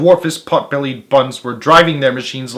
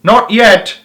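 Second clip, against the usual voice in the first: higher, louder shout; 9 semitones higher, 7 dB louder than usual. A narrator's reading. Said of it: "shouted Boggis".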